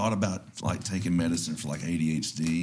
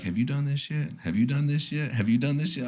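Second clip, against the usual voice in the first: lightly mimicked nagging, pitched higher; a higher-voiced hint of a pestering wife; imitating nagging wife; 7 semitones higher than usual. Husband quoting his wife's repeated question, lightly imitated nagging. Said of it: "because she'd be like".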